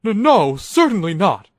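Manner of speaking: high, loud stammered denial; nervous engine snapping a defensive no; sharp reply. Acted. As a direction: deny it sharply, too fast to be believed. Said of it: "came the sharp reply".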